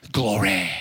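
deep church-accent GLORY, ending in RAY. Shown as a demonstration. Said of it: "say it with a church accent".

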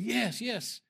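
excited retold outburst; amazed confirmation. Woman's amazed answer to the prophet.

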